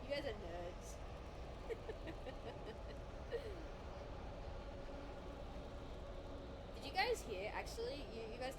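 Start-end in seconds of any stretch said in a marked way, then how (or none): 0.9-6.7 drawn-out fit of laughter; long laugh. Real, not acted. Streamer after calling chat nerds, long laugh. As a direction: keep laughing well past the joke.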